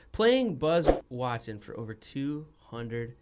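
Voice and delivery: ordinary talk, nothing marked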